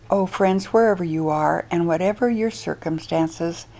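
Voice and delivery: ordinary talk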